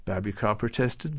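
ordinary delivery

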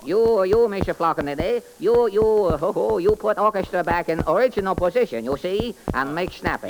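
sing-song comic detective character. Acted. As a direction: play the bumbling detective, chirpy. Detective questions suspects.

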